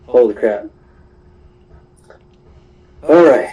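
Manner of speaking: usual speaking voice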